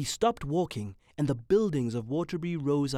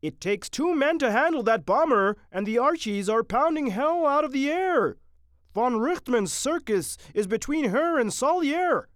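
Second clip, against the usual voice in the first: high, loud, alarmed sergeant; anxious; 12 semitones higher, 9 dB louder than usual. A narrator's reading. Sergeant warning Captain Mitty off a solo flight, anxious. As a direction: warn urgently over the bombardment. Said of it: "said the sergeant anxiously".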